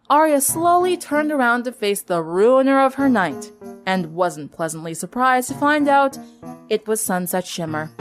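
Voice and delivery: usual speaking voice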